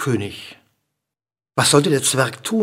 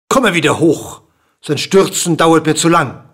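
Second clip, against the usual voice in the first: raised, loud order; impatient command; 3 semitones higher, 6 dB louder than usual. A narrator's reading. King tired of the dwarf's long fall, impatient command. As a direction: order him back up, fed up.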